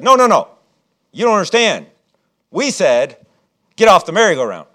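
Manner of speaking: loud, high, bossy order to clear off; teen bully threat. Retold or imitated. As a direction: bark it like a big teenager throwing his weight around. Teenagers threaten the kids.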